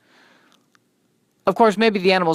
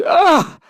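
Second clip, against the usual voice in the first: sob cry; 6 dB louder than usual. Acted. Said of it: "the name is just a sob".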